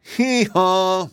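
high, held hee-haw; high two-note donkey call; loud mule bray. A narrator's reading. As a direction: bray like a mule making a point. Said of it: "uttered another loud".

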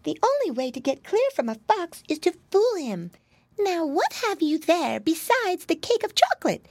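high-pitched storybook mouse; small, high creature voice; little mouse. A narrator's reading. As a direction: advise as a clever tiny mouse. Mouse advises the piglet.

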